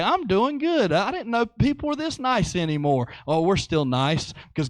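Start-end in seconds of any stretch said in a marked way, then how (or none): 0.0-3.1 a lightly voiced, higher, pleased old lady; grateful old lady. Helped old woman thanking the kind young man, lightly voiced. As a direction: lift it into a touched old woman.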